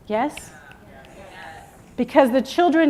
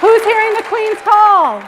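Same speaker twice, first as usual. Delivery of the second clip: much higher and louder, hyping the room; rallying call; 10 semitones higher, 8 dB louder, slower than usual. Acted. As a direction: call out to the crowd like an emcee. Rallying the crowd.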